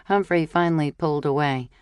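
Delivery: usual speaking voice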